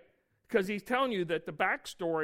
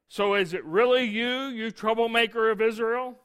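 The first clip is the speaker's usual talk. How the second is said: rising to an exclaimed question, louder; scripture reading; 2 semitones higher, 6 dB louder than usual. Acted. Preacher reads Ahab's outburst at Elijah, building louder.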